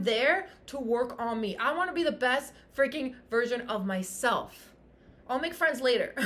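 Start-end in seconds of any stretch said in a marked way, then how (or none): none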